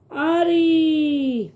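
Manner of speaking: "آری" with a long stretched آ; long drawn آ in "آری", teacher demo. Shown as a demonstration. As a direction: hold the آ of "آری" slowly. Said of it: "اس کی آواز تھوڑی کھچ کے آتی ہے".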